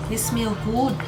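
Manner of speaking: ordinary delivery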